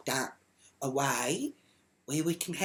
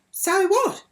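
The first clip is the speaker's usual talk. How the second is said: high, loud mock retort; imitating her, pitched way up; mimicked neighbour; 9 dB louder than usual. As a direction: throw it back in a high, mocking voice. Retold quarrel, mimicking the other woman.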